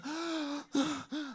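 acted gasps for air; short, reenacted breathless gasps; gasping for breath. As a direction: mime struggling to breathe with gasps. Acting out struggling to breathe.

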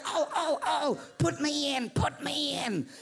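high, slow pleading voice; pleading five dollar bill. Acted. Five dollar bill begging the preacher, high pleading voice. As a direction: beg to be put in, drawn out.

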